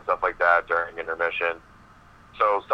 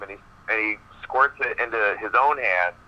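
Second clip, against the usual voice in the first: ordinary delivery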